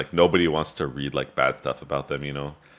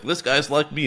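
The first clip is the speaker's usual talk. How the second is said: eager fan; 11 semitones higher than usual. Retold or imitated. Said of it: "some people who do like you".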